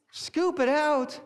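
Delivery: high, loud, drawn-out disbelief; incredulous echo. Retold or imitated. Panicking dad questioning his wife, acted incredulous. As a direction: throw the words back in acted disbelief.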